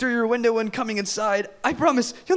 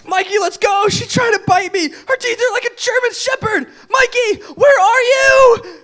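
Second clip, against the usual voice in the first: high, loud, fleeing in terror; frantic high shout, building; panicked shouting; 9 semitones higher, 6 dB louder than usual. Acted. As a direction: yell for your friend while running scared. Fleeing vampire, shouting for friend.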